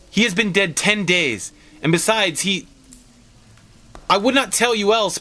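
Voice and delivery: put-on an old man begging his wife to stop, lightly shaken; shaking voice. Old husband refusing to face his dead son, a mildly shaky high plea. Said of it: "his voice shook".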